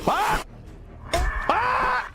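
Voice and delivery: high panicked screams; short bursts of high screaming; screaming for dear life. Real, not acted. Player screaming for their lives in the game, panicked screams. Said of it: "I'm screaming for our lives".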